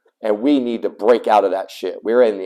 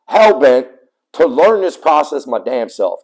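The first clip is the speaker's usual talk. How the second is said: ordinary talk, nothing marked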